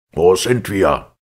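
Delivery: slow, loud furious repeat of the question; choking with rage. A narrator's reading. Captain shouting at the passenger who took his ship, furious. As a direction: bellow it out, barely holding the anger. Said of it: "rief er wiederholt".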